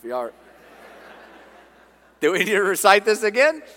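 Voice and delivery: laughing as he asks; amused teasing. Real, not acted. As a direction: tease the congregation through a laugh. Teasing congregation for not answering creed.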